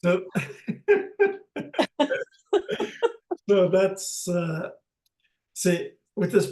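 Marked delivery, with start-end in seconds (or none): 0.3-2.2 a high, airy burst of real laughter; high breathy laugh. Real, not acted. Laughs at his own joke.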